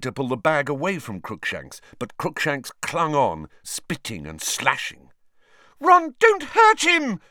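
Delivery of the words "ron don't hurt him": high, slow squealing plea. Acted. Hermione begging Ron not to hurt Crookshanks. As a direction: squeal in alarm for the cat. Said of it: "squealed Hermione".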